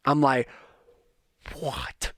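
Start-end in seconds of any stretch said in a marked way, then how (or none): 1.6-2.1 hushed, amazed whisper; stunned hushed delight. Retold or imitated. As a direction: whisper it as if you can't believe it. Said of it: "you guys don't know".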